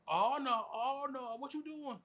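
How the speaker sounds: high, loud mock panic at the screen; high hammed-up alarm; mocking a loud theater talker. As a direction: play the guy narrating the movie out loud. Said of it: "just fucking narrating shit".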